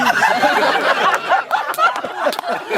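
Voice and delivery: high-pitched helpless laugh. Real, not acted. Laughing at his own mishap, high-pitched. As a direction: break up laughing.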